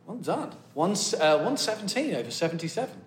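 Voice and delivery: quiet, gentle praise; soft nurse voice. Retold or imitated. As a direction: hush it like a kind nurse. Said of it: "she said".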